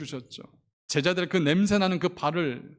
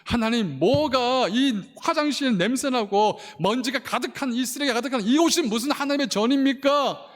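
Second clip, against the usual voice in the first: 항변; 7 semitones higher, 4 dB louder than usual. Retold or imitated. 하나님께 따지는 회상.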